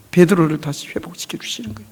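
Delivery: hushed whisper; soft unvoiced whisper of grace. Real, not acted. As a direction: breathe it like a secret. Tender point of restoration.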